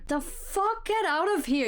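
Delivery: ordinary delivery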